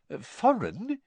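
high, baffled echo; puzzled. Acted. Mr Weasley puzzled by the question, high and baffled. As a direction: repeat the word not understanding it.

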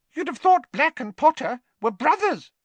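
very high, loud, squeaky professor; squeaky little voice chiming in. A narrator's reading. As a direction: pipe up eagerly in a tiny high voice. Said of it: "chimed in Professor Flitwick".

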